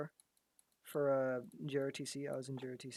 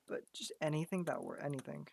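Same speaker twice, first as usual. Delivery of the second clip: normal talking voice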